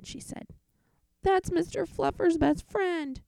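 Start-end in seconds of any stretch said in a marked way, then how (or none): 1.2-3.2 put-on high indignant child protest; high, outraged kid. Boy protests to mom, high and indignant.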